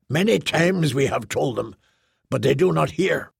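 usual speaking voice

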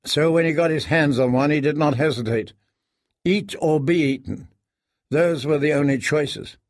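ordinary delivery

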